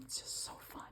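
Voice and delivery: a hushed little gush; delighted whisper. Real, not acted. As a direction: whisper it to yourself, thrilled. Delighted aside after showing check.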